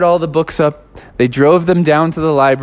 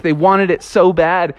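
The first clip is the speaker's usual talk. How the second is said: ordinary delivery, nothing marked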